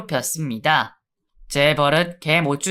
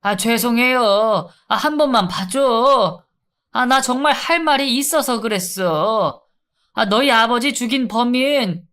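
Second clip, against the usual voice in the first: higher, urgent begging voice; desperate pleading; 6 semitones higher than usual. Acted. Inmate bargaining with the guard about the killer, high pleading.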